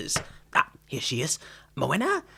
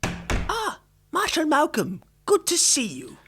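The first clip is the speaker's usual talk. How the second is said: high, gushing welcome; effusive greeting; 10 semitones higher, 3 dB louder, slower than usual. Acted. Tailor fawning over the arriving marshal, high and gushing. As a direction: fawn over the marshal as he walks in.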